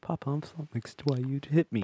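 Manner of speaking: lightly voiced British little boy, softly; a soft, unconvincing stab at a British child's accent; attempted British child. Son asking papa why he hit him, tentative British accent. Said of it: "your son with a British accent would say".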